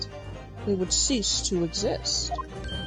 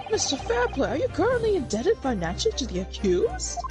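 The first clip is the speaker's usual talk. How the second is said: high-pitched lawyer voice; 7 semitones higher than usual. Acted. Voicing lawyer in court game.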